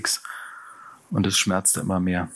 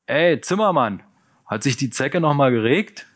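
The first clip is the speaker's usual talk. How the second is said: higher, loud shout forward; shouted call; 6 semitones higher, 3 dB louder than usual. Acted. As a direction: yell it down the train. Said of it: "brüllte plötzlich der Anführer".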